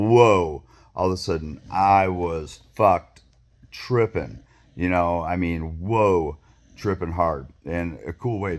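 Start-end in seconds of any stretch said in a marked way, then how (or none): 0.1-0.6 louder drawn-out whoa; loud retold whoa. Telling viewers how the trip kicked in, loud whoa. Mushrooms kick in hard. 5.8-6.4 louder drawn-out whoa; loud retold whoa. Telling viewers how the trip kicked in, loud whoa. Mushrooms kick in hard.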